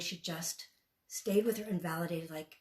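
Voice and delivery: ordinary talk, nothing marked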